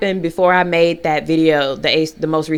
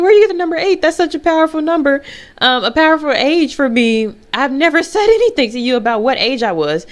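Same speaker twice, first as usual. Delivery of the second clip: amazed; 7 semitones higher than usual. Retold or imitated. Asking the physical therapist how she knew, astonished. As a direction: sound floored she guessed it.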